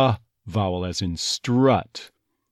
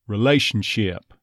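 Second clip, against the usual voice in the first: ordinary delivery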